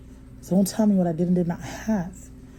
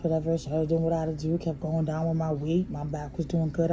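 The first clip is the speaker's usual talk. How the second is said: normal talking voice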